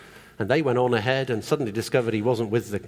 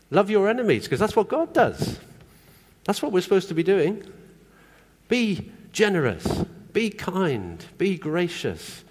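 preaching fervour; 6 semitones higher than usual. Real, not acted. Preacher drives the point home.

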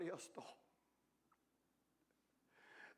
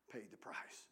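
hushed whisper; 7 semitones lower, 9 dB quieter than usual. Retold or imitated. Preacher retelling his words to a rich man, whispered. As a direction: drop to a whisper on the last words. Retold testimony ends hushed.

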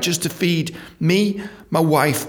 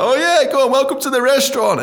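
restaurant owner; 10 semitones higher, 4 dB louder than usual. Retold or imitated. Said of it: "he's like".

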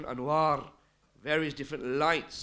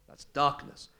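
ordinary delivery

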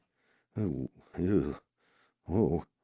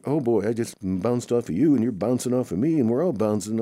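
excited yelps; 6 semitones higher, 6 dB louder than usual. Acted. Everyone bouncing off each other.

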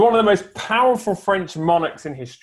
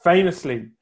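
ordinary talk

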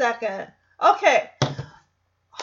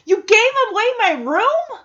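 high, loud mock outrage; retold outburst, pitched way up; imitating Jackson's outburst; 10 semitones higher, 7 dB louder than usual. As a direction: go off on her in a raised, indignant question. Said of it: "Jackson just goes off on her".

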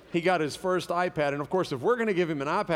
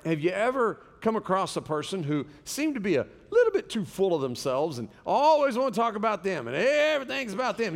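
ordinary talk

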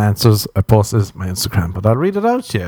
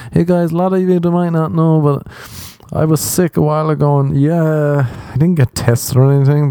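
mock fake-sick influencer; 5 semitones higher than usual. Comic mocking an influencer faking corona, in a high, syrupy voice. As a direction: parody a dramatic post-illness update. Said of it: "Just to get".

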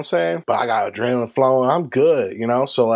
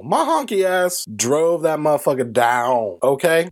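ordinary talk, nothing marked

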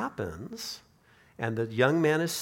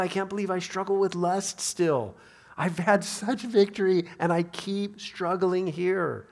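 raised pitch, pressing frustration; acted dismay, slowing into the last phrase; exasperated confession; 5 semitones higher than usual. Believer confessing a continuing struggle, exasperated.

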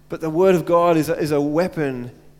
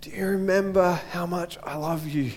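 a loving father gently comforting his son; gentle voice of God. Retold or imitated. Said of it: "gently says".

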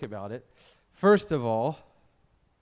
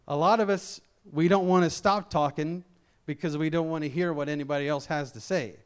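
ordinary delivery

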